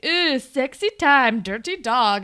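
put-on loud, slow Borat-like accent; weird accent. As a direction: imitate a leering, hammed-up Borat-style accent. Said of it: "a weird accent".

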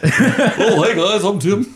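high, hammy self-parody; mocking himself. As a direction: send up your own voice. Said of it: "that was me making fun of myself".

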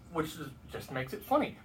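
ordinary talk

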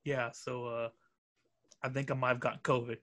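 low, quiet, grave phone confession; deadly serious. Retold or imitated. Imitating Malachi telling a friend he has covid, grave and hushed. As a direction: drop the voice like breaking terrible news. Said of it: "the way this man fucking said it".

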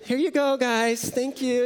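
high, bright hostess voice hiding annoyance; sing-song politeness with an edge; forced cheerfulness. Retold or imitated. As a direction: play the smiling server who's secretly fuming. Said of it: "and she's like".

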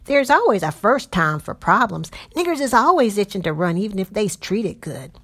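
loud, coarse Southern patroller, dismissive; a swaggering night rider brushing off worry; rough patroller voice. Acted. Patrollers argue in the wagon.

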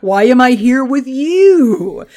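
loud, slow, drawn-out mock whine; exasperated complaint. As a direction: play an aggrieved hero, stretching the words. Acting Silver Surfer's complaint.

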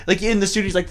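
ordinary talk, nothing marked

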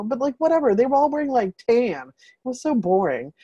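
usual speaking voice

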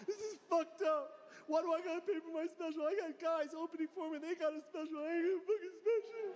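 high whiny complaint to the agent; mock crying rant. A hint of tearful whining to his agent about paying. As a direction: whine like you're about to cry. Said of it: "crying to my agent".

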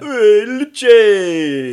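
slow, loud, high dumb guy call; dopey cartoon bellow. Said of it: "They all had the dumb guy voice".

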